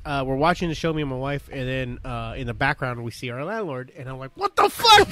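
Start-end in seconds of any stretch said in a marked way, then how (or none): 4.3-5.1 loud, high-pitched exclamation; shocked outburst. Retold or imitated. Man spotting his landlord on a Netflix show, shocked shout.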